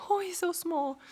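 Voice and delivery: high-pitched doting coo. Real, not acted. Coos at a little dog.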